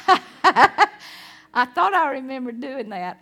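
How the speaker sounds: loud laugh through the words; laughing with relief. Real, not acted.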